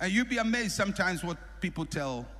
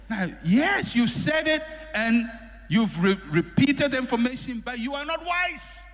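rebuking preacher; 9 semitones higher than usual. Real, not acted.

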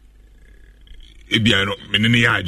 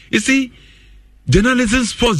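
emphatic outburst; 12 semitones higher than usual. Real, not acted.

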